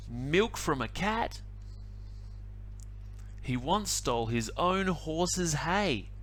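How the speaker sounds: usual speaking voice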